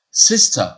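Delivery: sister, short i, loud and high. Shown as a demonstration. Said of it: "le I court". (sister avec un i court, fort)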